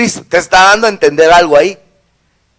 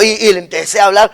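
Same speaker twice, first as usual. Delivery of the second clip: ordinary talk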